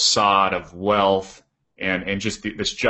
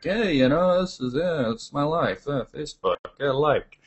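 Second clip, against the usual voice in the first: higher, dazed voice of a phone-absorbed person; mock phone-addicted user; 5 semitones higher than usual. Host mocks people glued to their phones, higher and dazed.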